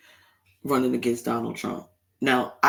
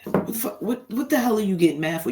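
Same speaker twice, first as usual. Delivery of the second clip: exasperated retort; 7 semitones higher than usual. Retold or imitated. Retorting to angry relative.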